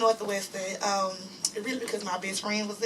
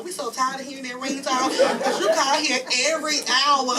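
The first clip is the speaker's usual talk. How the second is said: sister quoted, laughing; 3 semitones higher, 6 dB louder than usual. Retold or imitated. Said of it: "my sister was like".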